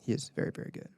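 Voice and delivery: breathy whisper of praise. Real, not acted. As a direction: say it under your breath. Hushed aside.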